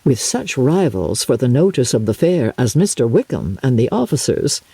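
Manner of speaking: plain reading voice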